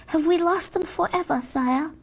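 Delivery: ordinary delivery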